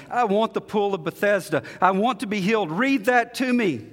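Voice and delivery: fervent plea. Retold or imitated. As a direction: beg for healing with rising intensity. Woman pleading to be healed.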